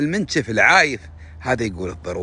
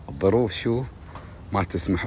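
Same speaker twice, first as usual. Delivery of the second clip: normal talking voice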